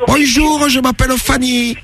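put-on high woman voice, Marseille accent; a Marseille girl on the phone. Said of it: "prends l'accent marseillais un peu". (accent marseillais, voix de femme)

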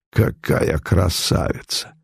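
breathless, slow admiring whisper; hushed awe at a passing beauty. A narrator's reading. Onlookers whispering in awe at the beauty. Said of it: "восторженный шёпот".

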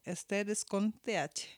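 usual speaking voice

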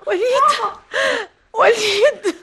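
put-on reach for your child through tears; crying out. Mother crying out to her son as he is taken away.

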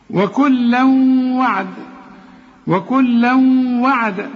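وكلا وعد with tanween hummed into a long w; idgham into waw. Shown as a demonstration. Teacher demonstrates merging, وكلا وعد joined by a held hum. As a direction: glide nasally from وكلا into وعد. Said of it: "الواو من حروف الإدغام".

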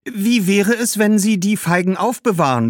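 put-on high-pitched, hopeful girl; suggesting young girl. Girl proposing he keep the figs, hopefully. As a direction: float a new idea.

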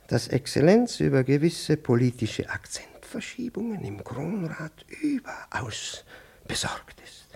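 hushed, conspiratorial whisper, slowing down; secretive whisper. Acted. Superior confiding a state secret to Nowikow, whispered.

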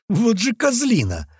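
worked-up slow insult; fuming. A narrator's reading. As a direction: seethe at the other driver. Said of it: "продолжал кипятиться кирпич".

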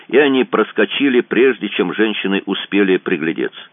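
plain reading voice

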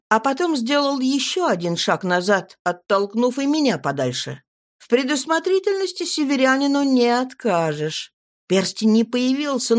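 ordinary delivery

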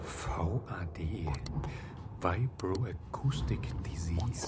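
slow, soft whisper to himself; hushed whisper. Acted. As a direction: breathe the words out slowly, alone at night. Alone at night reading. (langsames leises Flüstern)

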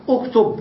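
اكتب said "uktub", starting on u; damma on hamza. Shown as a demonstration. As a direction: open اكتب with a short u. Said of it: "ننطقها بالضم".

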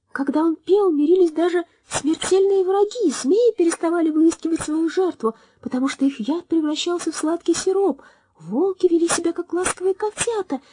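very high, sweet bird voice, tearful at the end; a tiny songbird telling a sad tale; chirping little bird. A narrator's reading. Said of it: "прощебетала маленькая птичка".

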